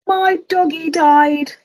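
high, slow child's wail, lightly voiced; a little kid mourning the dog, a hint of tears; crying child. A child telling the medium the dog died, high and tearful. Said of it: "a child cried".

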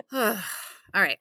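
normal talking voice

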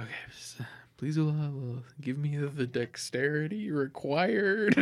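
hushed, breathless prayer; whispered prayer. Acted. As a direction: whisper a quick plea. Praying under breath, whispered.

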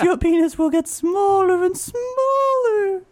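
slow, high, eerie prophecy; slow spooky ghost voice. Pretend. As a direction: intone it like a ghost. Playing Bloody Mary prophecy.

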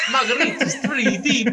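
put-on Italian accent. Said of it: "And he goes".

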